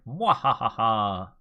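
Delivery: drawn-out villain laugh; hammy mwahaha laugh; slow evil cackle. Pretend. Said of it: "This is so corny".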